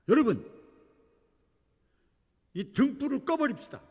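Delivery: high, loud rallying call; calling out to a crowd. Retold or imitated. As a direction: announce it boldly to everyone aboard. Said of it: "등장을 해가지고 얘기를 합니다".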